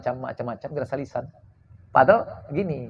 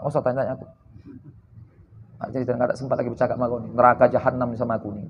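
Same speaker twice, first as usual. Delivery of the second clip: low, soft, hurried brush-off; gloomy; 5 semitones lower, 4 dB quieter, much faster than usual. Acted. Gloomy man refusing to tell a friend his troubles, downcast. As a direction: mumble a downcast refusal to chat, acted. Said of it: "satu lagi sedang muram ini".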